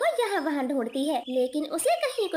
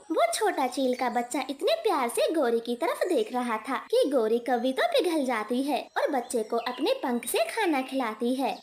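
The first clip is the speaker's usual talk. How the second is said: ordinary delivery, nothing marked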